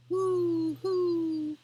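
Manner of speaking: high, loud hooting owl call. Acted. Reading Good Night Owl to children, high loud owl hoot. Owl hoots in bedtime book.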